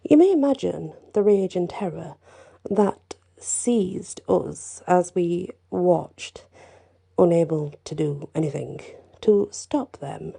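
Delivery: plain reading voice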